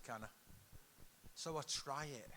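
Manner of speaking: ordinary talk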